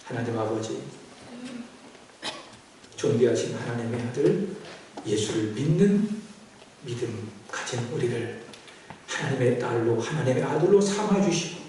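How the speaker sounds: low-pitched, measured prayer with pauses; solemn congregational prayer. Real, not acted. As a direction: pray reverently, phrase by phrase. Leads the closing prayer.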